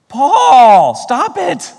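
high, loud plea to make him stop; mock pleading protest. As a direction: beg Paul to stop, half joking. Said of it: "talking back to Paul".

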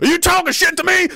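high-pitched, mock-aggressive bully; belligerent challenge. Acted warmonger to a doubter, mock belligerent. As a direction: challenge them like a touchy bully.

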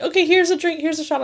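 high, cheery offering of drinks; pushy friends. Retold or imitated. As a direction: hand it over like an eager friend.

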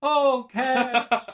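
high loud theatrical call; mock the grand ensemble reply; dramatic chorus imitation. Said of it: "everyone else says".

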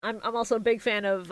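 normal talking voice

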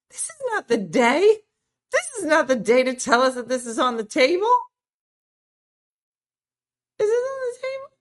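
high, loud, scandalized disbelief; mock outrage. Real, not acted. Host shrieking to her viewers over a gray eyelash, scandalized.

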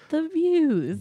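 "views" swooped high and long; dreamy emphasis. Shown as a demonstration. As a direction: sigh out "the views" with delight. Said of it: "repeats phrase with emphasis".

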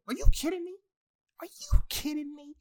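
very high, loud squeal of disbelief; squeaky, scandalized protest; incredulous outrage. Real, not acted. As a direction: let the voice leap up in exasperated disbelief. Outraged disbelief at double standard.

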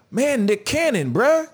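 high, drawn-out groan of disbelief; can't believe he said that, bruh; exasperated disbelief. Real, not acted. Exasperated reaction to [name].

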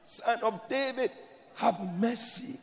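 high shout on Jesus, then fading to a slow plea; Bartimaeus shouting. Retold or imitated. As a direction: cry out high, then trail off begging. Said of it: "He shouted".

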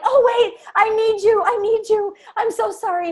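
high, loud pleading; desperate plea. Retold or imitated. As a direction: beg like you need him. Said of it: "until we're like".

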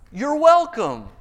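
high, loud, self-satisfied You're welcome; smug Saul. Acted. Saul to Samuel over the sheep, high smug you're welcome. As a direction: Saul acting like the teen who stole the watch. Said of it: "He says".

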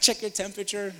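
a hint of the caring wife; wife's voice.

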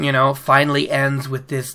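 ordinary talk, nothing marked

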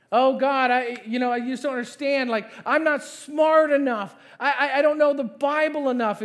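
high, loud pleading protest; protesting excuses. Acted. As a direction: plead your inadequacy, hammed up. Acts out excuses made to God.

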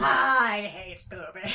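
drawn-out mock hatred; slow exaggerated disgust; slow deadpan. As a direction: let the words drag out. Punchline repeat.

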